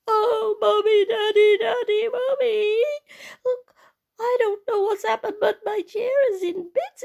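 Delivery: high, loud, tearful little bear; wailing Baby Bear. Acted. Baby Bear finds his chair in bits, wailing. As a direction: cry it out like a distraught small child.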